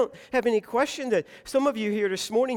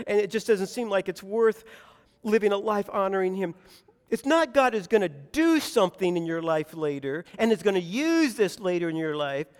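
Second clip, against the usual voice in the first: normal talking voice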